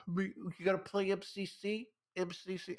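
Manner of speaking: high, nasal-free mocking gamer voice; mock nerd gamer. Husband mocking his wife's Xbox friends, high mock voice. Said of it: "she just hears her nerd Xbox friends".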